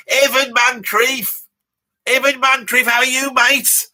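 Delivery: loud, high, drawn-out greeting; stretched-out, rowdy shout of welcome; hailing someone. Acted. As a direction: holler hello to a mate across the room.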